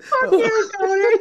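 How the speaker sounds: loud, high hammed-up yell; mock furious shout. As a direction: bark it back in a fake fight. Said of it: "very angry words being shouted".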